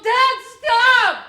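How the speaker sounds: full-throated yell, high and loud. Retold or imitated. Boy yelling at Dad on the mountain road, shouting. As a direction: scream at him to stop. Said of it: "I finally yell".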